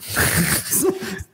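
high, breath-only giggle; helpless, voiceless wheeze of laughter; wheezing laugh. Real, not acted. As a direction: laugh until the voice drops out. Laughs at own hair joke.

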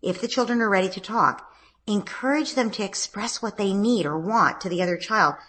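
usual speaking voice

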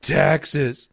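mild mock wail of a kid; mock crying. Crying kid losing at Monopoly, mock wail. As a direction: imitate a crying child, drawing out the word. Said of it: "He's crying. He goes".

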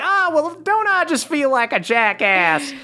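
high-pitched folksy embarrassed voice; sheepish crick elf. Acted. Elf learns he nearly burned a stump, high and sheepish. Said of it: "It's like".

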